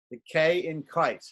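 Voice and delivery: "The K in kite" with a crisp K. Shown as a demonstration. Teacher repeating the K example for students. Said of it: "The first vowel is".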